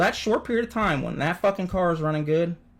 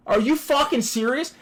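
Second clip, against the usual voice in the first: high, shocked outburst at the crash; can't believe what he just saw; stunned disbelief; 12 semitones higher than usual. Retold or imitated. Retelling watching the wreck, a high shocked cry.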